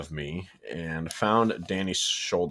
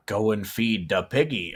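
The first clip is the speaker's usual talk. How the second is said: put-on pirate accent; 2 semitones higher than usual. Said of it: "It's a pirate".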